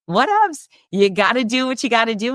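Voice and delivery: high, airy brush-off; breezy dismissive. Real, not acted. Brushing off illness.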